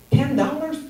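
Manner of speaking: high-pitched acted disbelief; stunned, rising question; incredulous. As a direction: echo the price in astonishment. Acted shock at low price.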